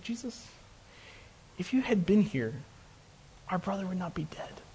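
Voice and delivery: very soft, grieving plea; very sad. Acted. Grieving sister to Jesus over her brother, softly sad. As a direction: voice sunk to a hushed, sorrowful near-murmur. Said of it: "she was very sad".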